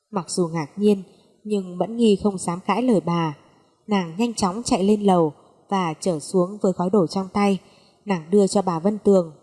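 ordinary delivery, nothing marked

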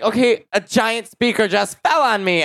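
high, slow retold disbelief; mock incredulous protest. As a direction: spell it out slowly in high, exasperated disbelief. Retold protest after speaker fell.

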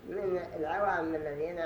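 usual speaking voice

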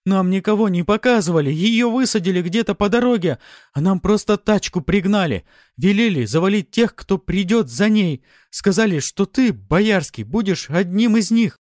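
high, loud panicked shouting; frantic pained wail of words; desperate confession. Acted. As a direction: yell it all out under torture. Tortured bandit yells out a confession.

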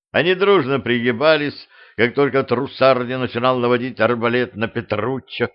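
ordinary delivery

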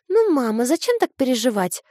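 выше, беззаботная девочка; ласково-беспечный ребёнок; детский голос. A narrator's reading. Дочь утешает мать. (детский голос)

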